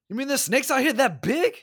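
very high, mock-incredulous [name]; [name] line delivery. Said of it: "just a funny line delivery".

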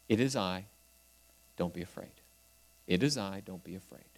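calm frightened hearts, very softly; gentle reassurance. Acted. God comforts his suffering people, soft and low. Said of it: "God is there saying".

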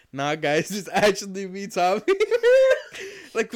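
laughing through a retold message; giggling as he voices the friend. Telling his cohost a funny gaming story, laughing through it.

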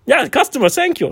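put-on high, loud German accent; German trainer relieved to find the word. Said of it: "is your accent German?".

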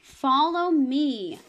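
slow and deliberate; slow, clear recitation. Shown as a demonstration. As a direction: say it slowly so kids can copy. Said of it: "Repeat after me with Weston".

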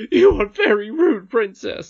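loud, high scolding nurse; angry rebuke. Acted. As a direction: scold the princess indignantly.

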